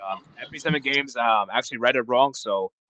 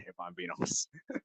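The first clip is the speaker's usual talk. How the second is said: laughing; 3 semitones lower, 10 dB quieter, slower than usual. Real, not acted. Giggling at own weak argument.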